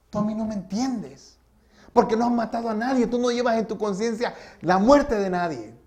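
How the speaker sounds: high, forceful, tormented protest; anguished accusation. Retold or imitated. Killer telling pastor he carries no death on his conscience, accusing.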